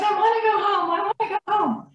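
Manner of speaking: imitated high, childlike tearful plea; Lance. Wife voicing crying husband who thinks he is young, tearful plea. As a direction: whimper it like a small child wanting home. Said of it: "he's just crying. He's crying".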